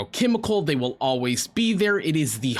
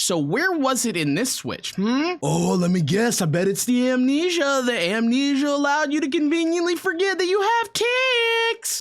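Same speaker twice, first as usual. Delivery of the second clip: mocking sing-song voice, much higher; sarcastic mockery; 9 semitones higher than usual. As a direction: taunt them in a high, sneering put-on voice. Sneering at a clip, sarcastic mockery.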